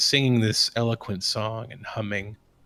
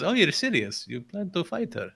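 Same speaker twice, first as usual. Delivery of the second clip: higher, swooping nervous questions; nervous disbelief; 7 semitones higher than usual. Acted. Priest asks party about plan to fight her, nervously incredulous. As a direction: question it uneasily, voice jumping up. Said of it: "he laughs nervously and says".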